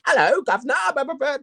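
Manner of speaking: high, fast hammed-up British accent, Cockney "Hello, governor"; mock British accent. Rapper mocking opponent's fake British accent, high hammed-up British accent. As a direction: parody a Londoner the way the opponent did. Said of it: "that accent that he was doing".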